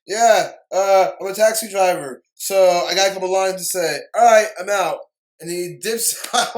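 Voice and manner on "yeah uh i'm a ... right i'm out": much louder, careless mock actor just saying his lines; mock Dan Aykroyd. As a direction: rattle it off loudly like you couldn't care less. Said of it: "He's just".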